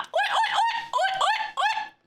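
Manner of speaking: high laughing "oi" cries; zebra call. Retold or imitated. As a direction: shout it like a braying zebra. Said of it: "that's the sound that zebras make".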